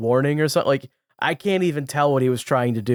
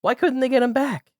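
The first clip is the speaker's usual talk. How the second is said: high voice; 11 semitones higher than usual. Acted. Playful high voice question.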